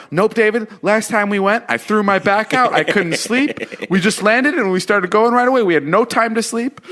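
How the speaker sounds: high, complaining mock whine; whiny Jason. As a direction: whine like a grumpy travel buddy. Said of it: "I know he's gonna go".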